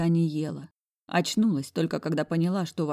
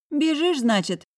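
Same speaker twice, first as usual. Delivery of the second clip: smirking; 10 semitones higher, 5 dB louder than usual. A narrator's reading. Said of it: "хмыкнула слишком прозорливая моя подруга".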